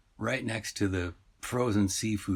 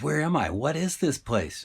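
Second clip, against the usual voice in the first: lightly voiced, higher-pitched confused fish; bewildered halibut; 6 semitones higher, 3 dB louder than usual. Lost fish questioning a shopper, higher and bewildered. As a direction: ask in raised pitch, bewildered. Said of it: "the fish goes".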